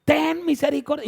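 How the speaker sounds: high, loud, drawn-out shout; sustained pleading yell; Bartimeo's cry. Acted. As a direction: call out at full voice, holding the vowels. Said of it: "el grito de Bartimeo".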